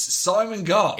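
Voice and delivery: dramatic reveal. Acted.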